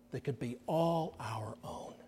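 soft intimate whisper; gentle whispered promise; hushed whisper. Real, not acted. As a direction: whisper it like a precious secret. Tender close of gospel point.

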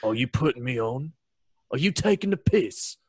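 a playful London geezer impression; mock British accent. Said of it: "a far better accent".

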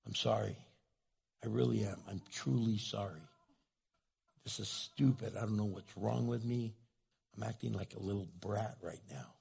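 low, soft, contrite; sheepish apology. Acted. Husband owning up to his wife, low soft sheepish apology.

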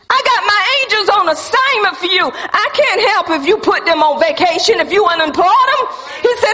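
high-pitched, driving preacher declaration; impassioned preaching. Acted. Preacher relaying God's promise of protection to the church, impassioned preaching.

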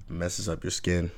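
ordinary delivery

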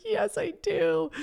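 put-on high, slow little kid answer; a kid admitting she needs a sick day; small child voice. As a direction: answer like a worn-out schoolkid. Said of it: "I felt like a kid in school".

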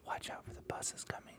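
unvoiced whisper to a child; mock whisper. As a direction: whisper a warning creepily. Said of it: "you go up to a child and you're like".

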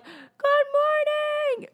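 very high, long drawn-out greeting; mock holler, stretched and high; imitated joyful yell. Speaker greeting God in prayer, mock yell. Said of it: "I yell at him".

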